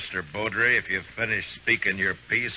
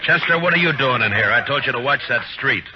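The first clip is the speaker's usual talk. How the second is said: raised voice, scolding the deputy; sharp scolding; 7 semitones higher, 6 dB louder than usual. Acted. Marshal scolds deputy for leaving the street, loud sharp rebuke. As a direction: snap at him for leaving his post.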